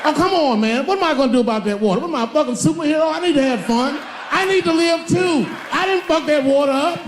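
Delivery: high, fast, shouting self-defense; mock indignant rant. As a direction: protest like a wronged superhero. Mock defending himself to Flint.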